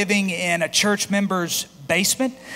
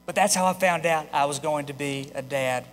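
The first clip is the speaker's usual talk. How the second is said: ordinary delivery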